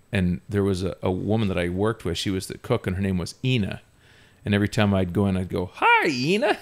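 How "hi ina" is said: very high greeting into a long cackle; hyena laugh greeting. Retold or imitated. Teenager greeting the cook with a pun, hyena laugh. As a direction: squeal the hello, then cackle like a hyena. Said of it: "why do you laugh like that".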